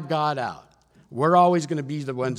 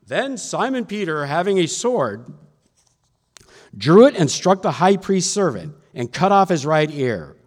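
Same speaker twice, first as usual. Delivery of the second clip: raised, emphatic Bible reading; scripture reading; 4 semitones higher, 7 dB louder than usual. A narrator's reading. Preacher reads John's account of the arrest, raised emphatic scripture reading. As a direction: proclaim the account, punching the key words.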